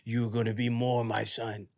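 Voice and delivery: low, measured inner voice; voice in the head. Retold or imitated. A voice in his head tells him, low and solemn. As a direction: speak as a solemn low voice from within. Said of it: "I hear a voice in my head".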